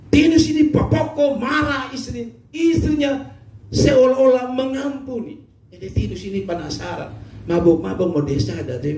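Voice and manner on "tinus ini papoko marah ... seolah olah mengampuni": excited storytelling. A narrator's reading. Preacher raises voice telling story.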